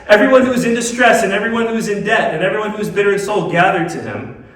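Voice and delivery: high, loud scripture read in one driving run; run-through scripture reading. Real, not acted. Preacher quoting scripture to the congregation, high running read. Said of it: "Let me read that again".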